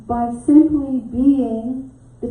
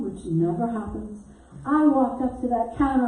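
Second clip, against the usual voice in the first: ordinary talk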